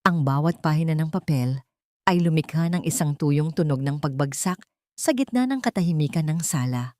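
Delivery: usual speaking voice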